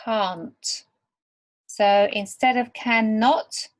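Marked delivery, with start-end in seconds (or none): 0.0-0.8 can't said slowly and clearly, long open vowel; careful can't. Shown as a demonstration. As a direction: model the word can't clearly for learners. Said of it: "this is a quite a difficult word".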